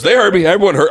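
high, loud defensive outburst; loud insistence. Acted. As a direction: insist at the top of your voice. Lion insists, then eases off.

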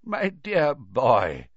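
put-on very slow, drawn-out old man's endearment; kindly old headmaster. A narrator's reading. Old headmaster prepares to refuse a pupil's request, kindly. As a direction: address the boy gently as an aged teacher. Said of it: "said Dippet kindly".

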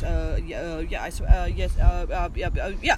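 lightly voiced flustered waffler, stammering; Boris. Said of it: "I'll call up Boris".

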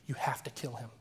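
low, hushed imitation of a commanding voice; retold inner voice. [name] quoting the voice in his ear, hushed. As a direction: keep the sinister order low and soft. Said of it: "voice just starts yelling in my ear".

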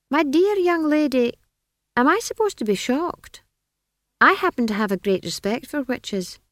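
high, condescending wizard voice; be an amused wizard humouring a child; lofty, high-pitched dismissal; patronizing wizard. A narrator's reading.

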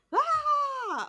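sudden high, loud yelped "Ah!"; imitated woman's scream. Retelling a ghost show's recorded scream, high mock shriek. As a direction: mimic the scream caught on the recording. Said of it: "a woman screaming type of thing".

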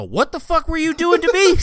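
a high, loud mock yell; retold yell. Yelling at the enemy player in proximity chat, loud and high. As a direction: fling it back at him, loud and high.

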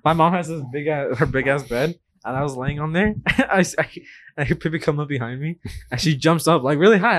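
normal talking voice

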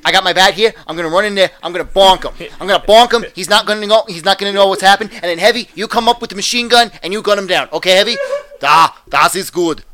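hammed-up Boston tough laying out a plan; Boston accent. Rallying Heavy to bonk a guy, Boston accent. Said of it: "now it's going into Boston".

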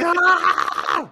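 very high, very loud shriek; full-volume terrified scream; blood-curdling scream. Acted. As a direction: scream as if chased by a grizzly. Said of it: "I would be screaming".

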